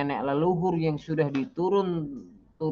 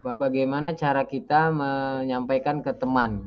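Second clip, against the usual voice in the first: ordinary talk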